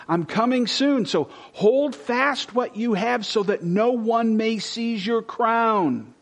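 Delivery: usual speaking voice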